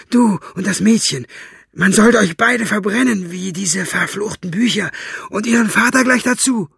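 high, loud hateful rant; furious shout. Acted. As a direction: rage at them, building. Buster ranting in hate.

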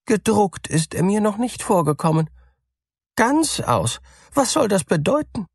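high, fast puzzled mouse voice; the mouse can't make sense of it; bewildered mouse. A narrator's reading. Mouse to cat, high, fast and bewildered.

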